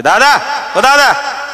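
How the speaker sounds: high, drawn-out call for big brother. Shown as a demonstration. Krishna showing Satyaki how to call, high and slow. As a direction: call him from the doorway, stretched. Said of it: "फक्त अशा हाका मार की".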